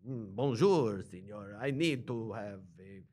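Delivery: slow, hesitant mock French accent; English dragged out in a bad French accent; fake French accent. Tourist ordering at a café, slow fake French accent. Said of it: "You're not French when you go".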